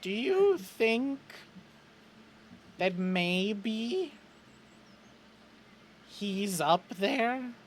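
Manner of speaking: high, slow, halting character voice; tentative hope. Acted. Chet wonders if Graham watches from above, haltingly. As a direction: ask haltingly, feeling your way toward comfort.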